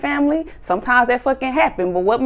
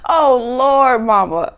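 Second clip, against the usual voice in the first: mock wail; 3 semitones higher, 6 dB louder, slower than usual. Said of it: "He's like".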